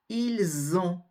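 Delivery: ils ont linked as "il-zont"; liaison with Z. Shown as a demonstration. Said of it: "Vous allez prononcer le Z".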